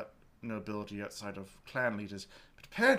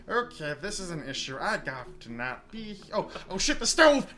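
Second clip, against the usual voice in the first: panicked realisation; 8 semitones higher, 8 dB louder than usual. Acted. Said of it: "He's like".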